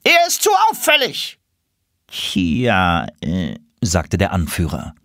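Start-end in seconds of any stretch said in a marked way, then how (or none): 0.0-1.4 very high, squawking duck protest; objecting drake. Acted. 2.1-3.5 awkward hedging. Acted. Leader embarrassed, hedging.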